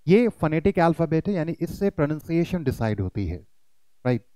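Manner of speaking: ordinary talk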